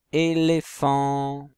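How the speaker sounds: Éléphant said slowly and a bit loudly, é-lé-phant; slow syllabified demo. Shown as a demonstration. Teacher to pupils, sounding out the word slowly. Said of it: "où est-ce qu'on entend le et?".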